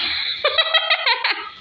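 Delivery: high-pitched giggling fit; burst of laughter. Real, not acted. Cracks up while teasing her brother. (ज़ोर की खिलखिलाती हँसी)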